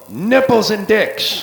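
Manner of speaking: laughing bar-name reveal; gleeful punchline. Acted. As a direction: announce it with glee.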